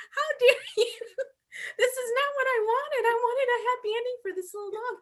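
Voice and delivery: high, loud outrage with laughter in it; mock angry commenters. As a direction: imitate indignant commenters, half wailing, half laughing. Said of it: "all the comments were angry".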